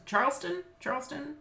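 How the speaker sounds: Charleston pitched high with a questioning rise; checking pronunciation. Shown as a demonstration. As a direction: query whether Charleston sounds right, lifting the ending. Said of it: "I'm saying it right".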